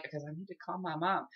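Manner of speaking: very soft, reluctant explanation; embarrassed. Retold or imitated. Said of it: "I was so embarrassed".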